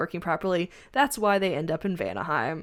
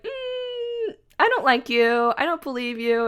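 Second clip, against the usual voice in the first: put-on high, louder pouty teenage defiance; sulky teen; 8 semitones higher, 5 dB louder, slower than usual. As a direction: pout it back like a stubborn kid. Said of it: "And Curse is like".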